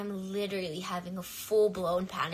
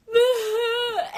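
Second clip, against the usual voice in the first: a high sob breaking into a laugh; crying laugh; 13 semitones higher, 9 dB louder than usual. Real, not acted. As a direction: let the tears spill through a laugh. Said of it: "I was so emotional".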